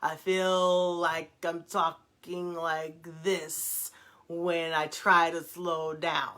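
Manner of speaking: very slow, drawn-out demonstration; snail's pace. Shown as a demonstration. Showing viewers how slowing down feels, exaggeratedly slow. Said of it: "it feels like I'm talking at a snail's pace".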